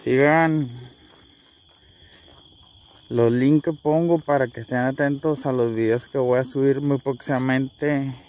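ordinary talk

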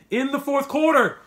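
sudden high, loud outburst of frustration; getting mad. Real, not acted. Fan ranting about a bad fourth-quarter call, loud and high. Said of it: "I don't wanna get mad".

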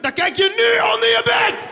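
hammed-up furious dad; angry father shout. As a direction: yell at the child to obey. Father yelling at child.

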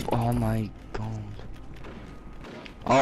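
ordinary talk, nothing marked

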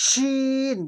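শিন with a long sh and held vowel; hushing শিন. Shown as a demonstration. Teacher contrasting it with Seen, long hushing sh in শিন. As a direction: draw out the hush at the start of শিন. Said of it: "শিনের মধ্যে সা সা আওয়াজ হবে".

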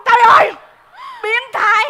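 loud, high outburst; shocked exclamation. Acted. Café seller overhears the wife's complaint, shocked cry. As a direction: blurt it out, appalled.

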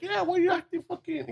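high, pushy mock confrontation; imitating an aggressive guy. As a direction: play the stranger getting in his face, half-mocking. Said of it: "he started getting aggressive".

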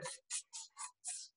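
soft unvoiced tongue clicks; monkey tsk-tsk.

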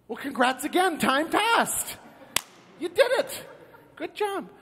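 high, climbing cheer; mock congratulations. Angel congratulating the man on wasting time, cheery. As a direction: chirp it brightly up into falsetto.

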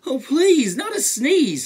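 high, loud, anxious plea; high-voiced bear begging for mercy; pleading bear. A narrator's reading. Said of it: "said the bear".

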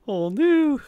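normal talking voice

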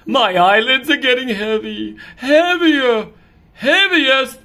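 hammed-up drowsy cat; sleepy cat.

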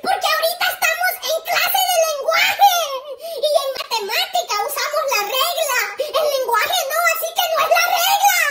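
high, hammed-up indignant wail; frantic protest. Boy argues with principal about class, frantic. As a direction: insist on it in mock hysteria.

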